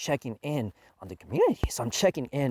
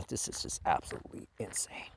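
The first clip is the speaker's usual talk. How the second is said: disbelieving whisper; 6 semitones lower, 14 dB quieter than usual. Real, not acted. Winding down in disbelief.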